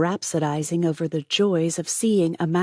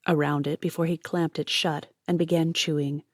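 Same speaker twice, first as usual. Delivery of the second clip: plain reading voice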